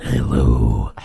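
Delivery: very low booming greeting; Tony Todd voice. Retold or imitated. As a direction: greet in a deep villain voice. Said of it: "I can't keep that joke going".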